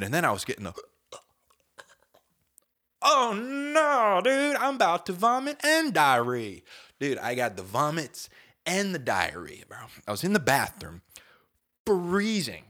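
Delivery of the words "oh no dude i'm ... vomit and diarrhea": high, loud crying whine; high whiny wail. Retold or imitated. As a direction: wail like a sick baby. Whining about illness.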